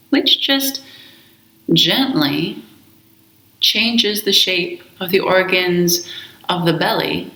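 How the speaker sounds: slow, calm meditation voice with long pauses; guided meditation. Acted. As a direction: guide them gently into the breath, unhurried. Leads a guided breath meditation.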